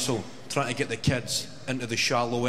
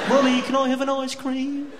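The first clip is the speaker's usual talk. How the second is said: put-on high, louder child voice, far off laughing; whiny kid asking; 14 semitones higher, 5 dB louder than usual. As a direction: whinge for an ice cream. Kid voice repeated in story.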